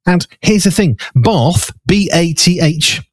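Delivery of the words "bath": BATH with a long "ah"; long open back vowel in "bath"; Queen's English long vowel. Shown as a demonstration. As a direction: say "bath" as "bahth". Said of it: "because she'd say grass and bath".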